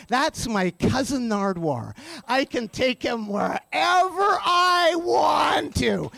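slow, emphatic declaration; re-enacted imperious put-down; defiant. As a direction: stretch it out grandly, rising on the key words. Courtney overrules door staff, acted.

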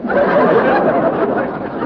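high breathy laugh. Real, not acted. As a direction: break up laughing. Laughs at the joke.

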